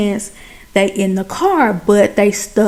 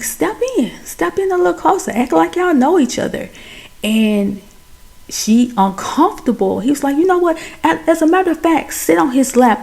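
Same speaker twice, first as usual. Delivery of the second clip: higher, coaxing retold directions; lightly voiced caricature artist; 7 semitones higher than usual. Caricature artist posing the two, light coaxing. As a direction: coax them lightly in a raised voice.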